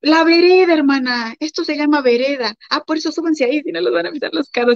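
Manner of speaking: put-on high, insistent explaining to kids. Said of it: "retold street exchange". (explicación insistente en voz aguda)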